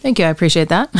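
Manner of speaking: ordinary talk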